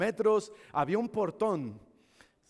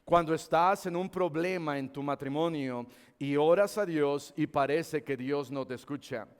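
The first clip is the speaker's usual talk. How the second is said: ordinary talk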